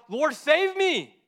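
high, loud drawn-out cry for help; panicked yell. Acted. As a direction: yell out in acted terror. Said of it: "he yelled out".

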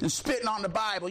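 usual speaking voice

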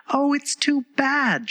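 high, drawn-out cry of dismay. A narrator's reading. Alice finds herself at the door again, crying out. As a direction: cry out in high, slow exasperation. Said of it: "she cried".

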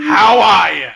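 loud, high put-on neighbor voice; big mock-friendly shout; hammy neighbor greeting. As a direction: holler a goofy hello. Put-on voice as Stan.

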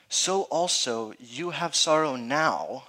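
slow, solemn reading of the promise; slow scripture reading. Acted. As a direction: read it slowly, letting it land. Reading Jesus' words.

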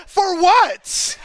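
high, laughing disbelief; mock-baffled "for what"; incredulous. To his wife about the gown, laughing disbelief.

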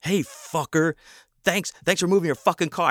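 high-pitched sarcastic rant, not shouted; mock-furious sarcastic thanks; retold angry outburst. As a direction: re-enact going off on someone, pitch jumping up. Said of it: "I fucking went off on him".

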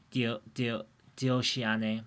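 "dioshiane" slowly with a D onset, restarting; careful D pronunciation. Shown as a demonstration. Demonstrating the fixed word for listeners, with a careful D. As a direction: sound out "dio-" then the whole "dioshiane". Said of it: "So it'd be like".

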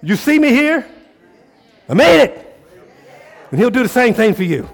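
loud, high, fired-up preaching; full-force preaching. Real, not acted. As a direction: preach it hard to the crowd, almost shouting.

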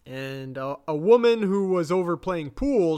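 ordinary delivery

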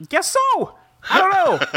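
"Guess so" jumped up high, falling at the end; squeaky mock echo. Shown as a demonstration. Host echoes own reply, mock high and squeaky. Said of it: "Yeah, I guess so".

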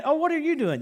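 gloating taunt. Pretend. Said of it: "I'm gonna go".

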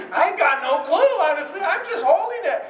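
loud, high, laughing admission; comic confession. Retold or imitated. Uncle admits he didn't pick the gift, loud laughing confession. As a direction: blurt it out with comic helplessness.

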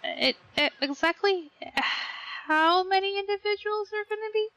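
nervously backpedal, pitch lifting; hesitant, polite traveller. Acted. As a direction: stammer politely into a careful question. Player voices character questioning crewman.

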